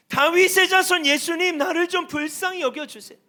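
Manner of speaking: high, loud imitation of a pleading shout; crying out. Preacher voices blind beggar begging Jesus for mercy, crying out. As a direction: call out urgently as the blind beggar. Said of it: "이 소경이 외치고 있는 거예요".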